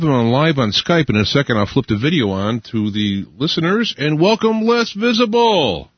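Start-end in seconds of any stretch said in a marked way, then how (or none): 4.0-5.9 high, drawn-out guest welcome; big radio welcome, pitched up; show-host introduction. Real, not acted. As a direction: announce the guest, stretching out the name.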